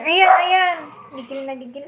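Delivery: usual speaking voice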